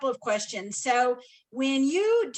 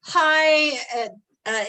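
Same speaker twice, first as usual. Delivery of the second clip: usual speaking voice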